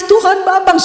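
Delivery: high mock tearful prayer; pura-pura menangis. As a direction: plead with badly faked crying. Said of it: "kalau saya di rumah menangis". (pura-pura menangis sambil berdoa)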